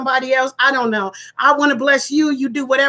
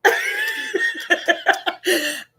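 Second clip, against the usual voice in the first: high squealing laugh; sudden helpless laugh; laughter bursting out; 4 dB quieter than usual. Real, not acted. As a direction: lose composure into a cackle. Laughing fit breaks out again.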